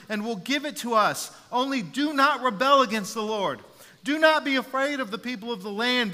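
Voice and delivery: loud, high rallying speech; passionate imploring. Acted. Joshua urging the Israelite assembly not to fear, passionate. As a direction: fire up the crowd like a coach before the big game. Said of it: "He's passionate. He's excited. He's imploring the people".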